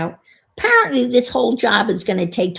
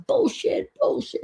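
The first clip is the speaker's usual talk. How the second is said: screaming into the phone. Retold or imitated. Screaming at the Pella hold message, mock outrage. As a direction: yell bullshit back at the hold recording. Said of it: "and screaming into the phone".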